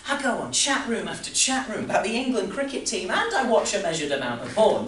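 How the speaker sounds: high, loud, escalating confession; agitated rant. Acted. Agitated confession of online habits, escalating.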